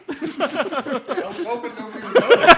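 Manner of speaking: real helpless laughter, high and loud; laughing hard. Real, not acted. Caught without headphones by co-hosts, cracking up. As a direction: talk through a laughing fit.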